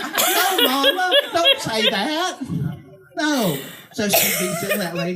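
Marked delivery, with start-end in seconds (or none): 0.0-3.6 higher, louder mock plea to mama; mock embarrassed plea; protesting quote. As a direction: beg mama to stop, laughing. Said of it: "I'm like".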